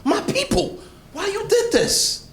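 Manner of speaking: a wronged king demanding answers; mock indignant king. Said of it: "He said".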